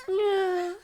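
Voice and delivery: very high, loud squeaky yes; high character yeah. Acted.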